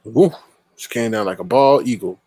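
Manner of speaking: ordinary talk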